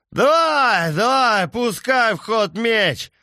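put-on high, loud drunk taunting yell; drunken shout.

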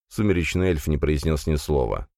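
usual speaking voice

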